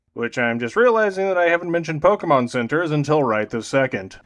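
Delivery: ordinary talk, nothing marked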